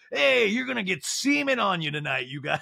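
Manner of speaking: high mock announcement; strip-show MC. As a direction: parody an emcee warning the crowd. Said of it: "the M C's like".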